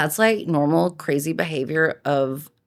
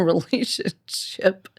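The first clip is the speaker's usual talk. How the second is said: usual speaking voice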